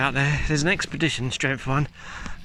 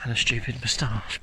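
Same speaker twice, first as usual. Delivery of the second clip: self-mocking whisper, drop to breath; whispered aside; 4 semitones lower, 5 dB quieter than usual. Real, not acted. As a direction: whisper it like a confession. Self-mocking aside about himself.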